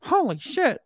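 very high squawky bird voice; talking parakeet. Retold or imitated. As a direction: squeak it out like a parakeet. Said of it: "and the parakeet goes".

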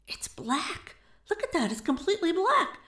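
high, hushed-to-voiced astonishment; scandalized, rising in pitch; shocked gasp. A narrator's reading. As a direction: gasp it out as a stunned onlooker.

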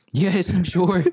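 irritated mock reply, pitched high; exasperated. Exasperated reply to repeated question.

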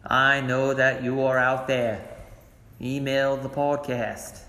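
bad, slow presidential impression; drawn-out speechifying, badly imitated; Kennedy. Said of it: "that was my Kennedy. It was really bad".

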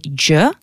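d and j joined for dje; Albanian GJ sound. Shown as a demonstration. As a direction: dje as a rising "djuh". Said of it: "ça fait".

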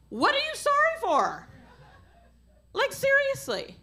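high-pitched, incredulous outburst; exasperated challenge. Acted. Preacher challenging needless Canadian apologies, exasperated. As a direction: pitch leaps up in disbelief.

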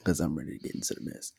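whispered quick tease. Real, not acted. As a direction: lean in and whisper it fast. Hyping up the reading.

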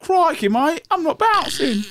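high, put-on Aussie denial; Australian accent. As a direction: protest like a cartoon Australian.

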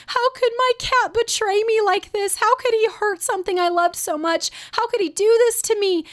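high-pitched mock wail of a hurt child; betrayed daughter. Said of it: "She literally kept using words like".

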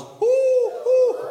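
very high, loud hooting; loud owl hoots. Acted.

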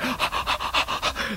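acted breathy gasps of excitement; overwhelmed gasping. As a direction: gasp as if overcome by revelation.

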